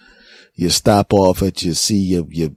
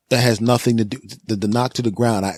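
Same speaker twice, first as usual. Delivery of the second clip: usual speaking voice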